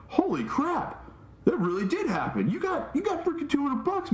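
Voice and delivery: high-pitched astonished friend; imitated amazement. Friend finds proof of the windfall, high imitated amazement. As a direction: act out his stunned discovery, voice way up.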